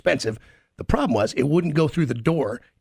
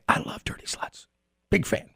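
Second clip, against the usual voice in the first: whispered aside; 3 semitones higher, 6 dB quieter than usual. Real, not acted. Confessional aside, dropped voice.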